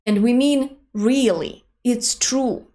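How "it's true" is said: ordinary talk, nothing marked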